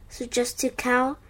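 ordinary delivery

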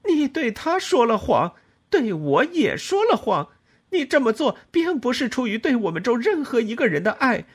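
高声尖利地怒骂，越喊越狠; 冲着情人把憋着的怒火全喊出来; 愤怒喊叫. A narrator's reading. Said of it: "我喊道". (愤怒地高声喊叫)

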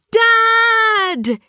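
high, loud yell for Dad. A narrator's reading. Kipper calling Dad from bed, loud yell. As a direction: call Dad from upstairs at full voice. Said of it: "he yelled".

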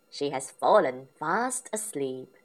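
usual speaking voice

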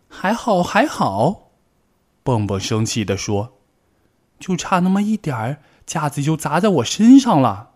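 angry. Acted. Bengbeng to Tiaotiao, angry and shaken. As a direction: complain it nearly fell on you.

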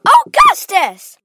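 very loud, high, drawn-out yell; mother bellowing her son's name; shouted. A narrator's reading. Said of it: "shouted Missus Gloop".

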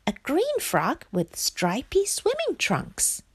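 high little frog voice, thinking aloud; pensive frog. A narrator's reading. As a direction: muse on yourself as the frog. Frog reflects in thought.

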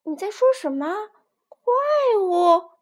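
high, startled question; a touch of shocked disbelief. Acted. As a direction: echo the word in alarm. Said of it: "皮迪克吃了一惊".

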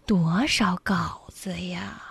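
slow, soft, half-whispered; sloth. Pretend. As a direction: linger lazily on each word. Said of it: "用树懒的方式来说话".

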